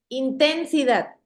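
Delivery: intensidad with the stress wrongly on the middle TEN, inTENsidad. Shown as a demonstration. Teacher testing a wrong guess, intensidad stressed on TEN. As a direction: punch TEN in intensidad, let -sidad fall away. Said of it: "en la sílaba ten, sonara así".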